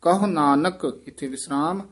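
ordinary talk, nothing marked

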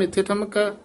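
ordinary talk, nothing marked

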